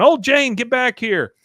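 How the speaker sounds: high, loud shout after her; shrill yell. Retold or imitated. Cult leader yelling after Peggy, high and loud. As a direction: screech at her to come back.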